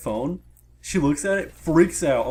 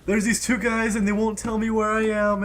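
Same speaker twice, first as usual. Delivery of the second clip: retold screaming; 8 semitones higher than usual. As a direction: mimic her screaming in a raised pitch. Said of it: "she starts screaming".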